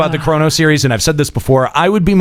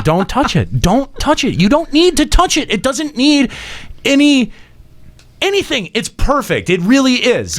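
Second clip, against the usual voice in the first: protective pleading; 9 semitones higher than usual. Retold or imitated. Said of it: "I'm like".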